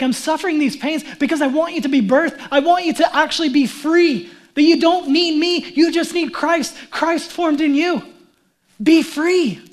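fervent preacher voicing a longing; impassioned plea. Acting Paul's anguished longing for them.

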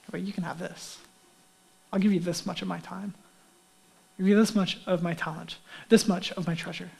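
subdued, minimal-effort murmur; grudging offering. Acted. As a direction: hand it over quietly, holding most back. Voices a stingy offering to God.

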